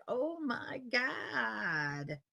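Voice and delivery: very slow, stretched Oh my God; elongated Valley Girl vowels. Shown as a demonstration. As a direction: pull every vowel long like a Valley Girl. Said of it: "Elongated vowels like".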